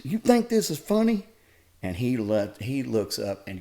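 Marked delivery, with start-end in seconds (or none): 0.0-1.3 angry coach. Retold or imitated. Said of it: "And Randy goes".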